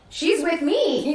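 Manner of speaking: high and very slow, each word laid down; pointed retort. Retold or imitated. Retelling her reply to the clerk, high, slow and pointed. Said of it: "And I was like".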